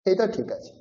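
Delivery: normal talking voice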